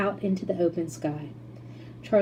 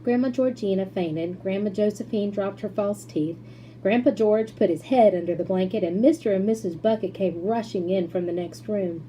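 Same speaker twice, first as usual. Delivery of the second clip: ordinary delivery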